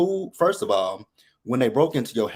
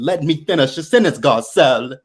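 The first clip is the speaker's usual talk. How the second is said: loud, raised-pitch mock scream; imitated scream; 4 semitones higher, 7 dB louder than usual. Imitates Dorit screaming at Garcelle at the reunion, loud mock scream. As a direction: yell it as an indignant interruption. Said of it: "she screamed at Garcelle at that reunion".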